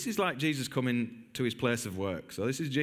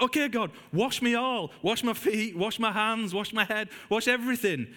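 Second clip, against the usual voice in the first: breathless all-in enthusiasm, pitched way up; eager Peter; 8 semitones higher than usual. Retold or imitated. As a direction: blurt it out as if begging to be washed all over. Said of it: "He's like".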